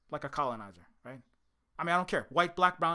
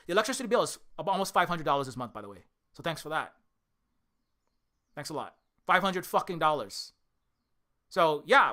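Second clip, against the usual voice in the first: ordinary delivery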